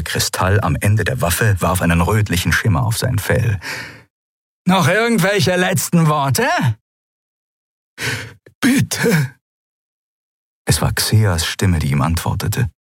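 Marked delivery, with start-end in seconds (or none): 4.7-6.5 high, rasping scornful voice; rasping sneer. Acted. Draxyl taunting before shooting. 8.6-9.3 put-on old Xea pleading with the guard.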